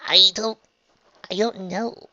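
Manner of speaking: high, uncertain false start; hesitant. A narrator's reading. Trixie answers Raindrops' question, hesitantly.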